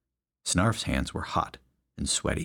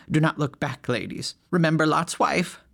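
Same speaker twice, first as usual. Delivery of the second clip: higher, prim command; commanding pious lady; 8 semitones higher than usual. A narrator's reading. Leader ordering her flock away, higher prim command. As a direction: order the ladies off sternly.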